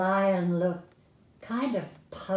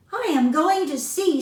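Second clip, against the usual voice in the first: higher, louder little voice; a lightly voiced brave mouse; determined mouse; 8 semitones higher, 7 dB louder than usual. A narrator's reading. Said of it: "said Fuzzytail".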